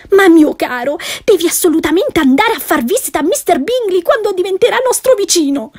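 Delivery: shrill-free high urgent plea; pleading wife. Acted. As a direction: beg him, pitch rising.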